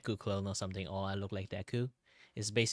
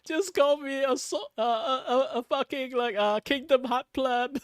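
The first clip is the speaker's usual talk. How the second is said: mock rant; 13 semitones higher, 7 dB louder than usual. Streamer mocking fans who call them a Sora clone, mock indignant. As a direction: ham up an indignant protest.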